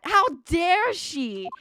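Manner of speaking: outraged that someone died; real outrage. Real, not acted. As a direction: drag it out in disbelief. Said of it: "I, I am so angry".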